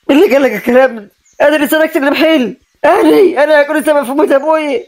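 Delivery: stung, high-pitched disbelief; high, wounded indignation; indignant protest. Acted. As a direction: protest the accusation with rising outrage. Accused man protests the charge.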